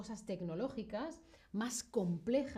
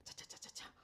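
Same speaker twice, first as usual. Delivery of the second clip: whispered typing noise; 17 dB quieter, faster than usual. Pretend. Mimics hacker typing online.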